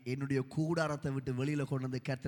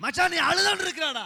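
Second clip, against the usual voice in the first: loud, high imitation of a friend; retold insistence; 13 semitones higher, 10 dB louder than usual. Friend tells him he is crying, loud retold insistence. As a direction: insist loudly, pitched well up.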